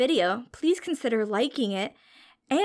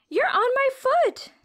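much higher, louder squeaky little complaint; squeaky tiny bacterium; 13 semitones higher, 3 dB louder than usual. Acted. Crowded bacterium complaining to its neighbor, high squeaky voice. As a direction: squeak it out like a tiny squashed germ.

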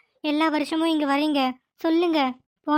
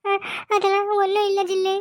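halting, choked-up words through tears; trying to hide the crying from the child; tearful stammer; 3 semitones higher than usual. Acted. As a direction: grandmother stammering while crying. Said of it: "அப்புறம் ஏன் பாட்டி இப்படி அழுற?".

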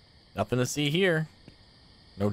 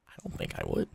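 whispering; 9 dB quieter, faster than usual. Real, not acted. Muttered aside.

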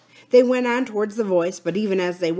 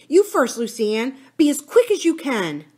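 higher, pressing instruction; anxious urging; 6 semitones higher, 4 dB louder than usual. A narrator's reading. Philip sending Lucy Ann up first, anxious. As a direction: hurry her along with worry. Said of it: "said Philip anxiously".

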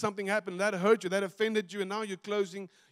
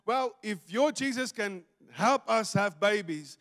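ordinary talk, nothing marked

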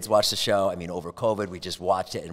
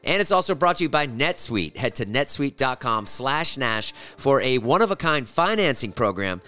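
sponsor ad read; 5 semitones higher, 4 dB louder than usual. Acted. Host reads sponsors to podcast listeners, promo read. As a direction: read it like a polished sponsor spot.

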